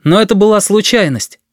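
high, firm declaration; firm boy speaking up. A narrator's reading. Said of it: "затем твёрдо добавил".

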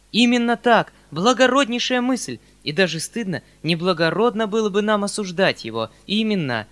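high, loud enthusiastic praise; rapturous. A narrator's reading. Uncle praises his nephew's idea, loud and rapturous. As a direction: gush with delighted approval. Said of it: "вскричал дядя в восторге".

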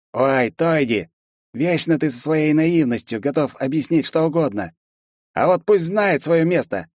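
high, scornful lizard voice; sneering guard. A narrator's reading. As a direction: sneer down at the new boy. Cruel guard mocking newcomer.

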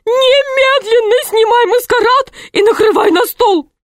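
high, commanding wife; bossy wife. A narrator's reading. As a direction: bark orders like a bossy wife. Wife orders from behind door.

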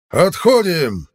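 long, high shouted order; retreat shout. A narrator's reading. Fighter calls retreat to his crew, loud shout. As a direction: yell it out to the whole crew, drawn out. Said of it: "крикнул драный плащ".

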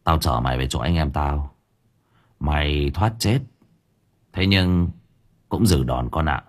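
understated menace, low-pitched; threatening. A narrator's reading. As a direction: drop the voice to warn him. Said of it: "liền đe dọa".

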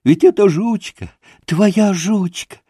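high-pitched overjoyed father, nearly crying; blissful cries. A narrator's reading. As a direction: call out in childlike rapture. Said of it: "выкрикивал он блаженным голосом".

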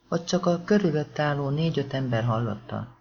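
ordinary delivery, nothing marked

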